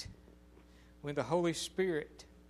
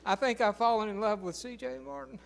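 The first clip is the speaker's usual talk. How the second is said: daughter's confession; 6 semitones higher, 5 dB louder than usual. Retold or imitated. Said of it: "daughter says, can't even say it".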